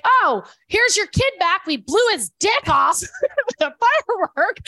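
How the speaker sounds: high, slow, hammed-up announcement breaking into laughter; loud, high comic retelling through laughter; animated mom. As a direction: mimic a mom's over-the-top handover, loud and laughing. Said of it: "she's very animated".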